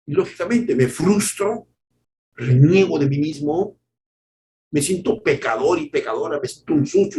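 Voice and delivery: usual speaking voice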